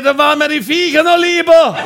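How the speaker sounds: exasperated loud shout, high; neighbour yelling. Retold or imitated. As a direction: yell it over the fence. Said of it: "Der Nachbar geschrien".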